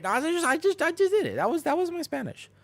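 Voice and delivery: very high, loud squeal; squealed protest. Real, not acted. Streamer defending his Spanish to viewers, squealed protest.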